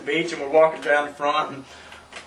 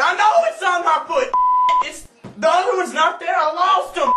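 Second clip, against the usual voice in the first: exasperated yell; 11 semitones higher than usual. Retold or imitated. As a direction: shout it like she just isn't getting it. Arguing with girl about sandal.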